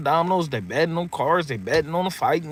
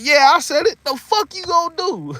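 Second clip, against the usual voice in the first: higher, laughing defiant brag; cocky Mike; 13 semitones higher than usual. Retold or imitated. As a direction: own it like Mike on TV. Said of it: "He's like".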